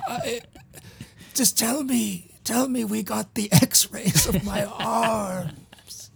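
hammed-up last words to the boss, laugh breaking in; mock dying man. Plays the dying assistant's last words.

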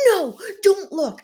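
high, loud, drawn-out mock scream; hammed-up aggressive shriek; imitated screaming. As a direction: mimic someone frantically scolding across a table. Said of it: "screaming at me".

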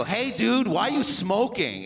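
high-pitched, incredulous question; mock dismay. Retold shocked question to costumed character.

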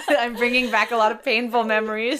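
giggling as she jokes at her guest; laughing. Real, not acted. Host laughs while teasing her guest about painful memories.

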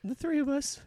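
ghost lady voice. Acted. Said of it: "Lucinda absorbs Tom".

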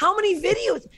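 high, worked-up outburst of disbelief; exasperated rant about the award night; heated rant. Real, not acted. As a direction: get heated, voice climbing high. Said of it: "I'm getting heated again".

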